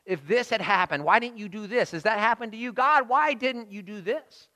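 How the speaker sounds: anguished questioning that climbs in pitch; pleading complaint. Acted. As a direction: cry out in rising protest to God. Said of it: "How many times have we said that to the Lord".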